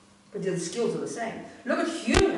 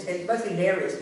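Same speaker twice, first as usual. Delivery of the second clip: normal talking voice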